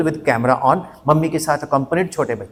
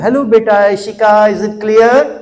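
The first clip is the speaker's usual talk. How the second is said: put-on higher, louder, sing-song teacher checking on a child; sweet teacher voice; 6 semitones higher, 5 dB louder, slower than usual. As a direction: play the overly sweet online teacher. Imitating teacher in online class.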